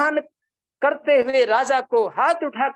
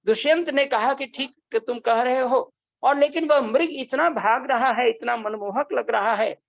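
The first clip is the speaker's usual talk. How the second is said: ordinary talk, nothing marked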